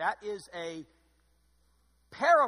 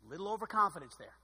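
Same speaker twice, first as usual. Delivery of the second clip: ordinary talk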